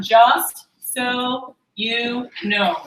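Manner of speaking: very slow, word by word; dictation pace. Shown as a demonstration. Trainer dictates a money phrase for trainees to write, slowly. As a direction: spell out the phrase slowly, pausing between words.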